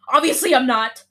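loud, high indignant snap; anger. Acted. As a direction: play anger personified, fed up. Anger voiced in a story.

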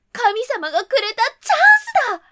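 loud, high joyful cry; delighted. A narrator's reading. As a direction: shout with glee at the chance. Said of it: "喜んで".

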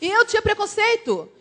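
normal talking voice

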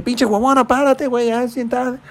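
put-on high eager kid voice; excited kid. Little boy waking his mom, high and impatient.

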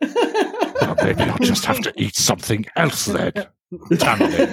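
high laughing mock sulk; Gastro voice. Gastro teasing Tamale, high with laughter. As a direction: sulk back at her, laughing.

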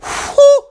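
very high, loud whistled whew; high, loud whew of awe at the third season; hype whew. Real, not acted. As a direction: burst out a piercing whew over the show. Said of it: "I'm gonna stop doing that".

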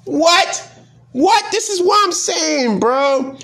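can't believe what you just read; stunned disbelief. Real, not acted. Told the town thought the ad was a candidate, stunned high disbelief. Reacting to townspeople voting for powder.

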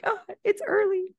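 high-pitched, pleased reaction; delighted recognition. Retold or imitated. Reader greeting a favorite character's storyline, delighted.

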